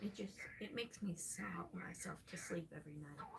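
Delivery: hushed whisper, a touch of fake self-pity; mock sad whisper. Streamer pretending bad parenting makes her sob, mock sad whisper. Mock confession of sobbing nightly.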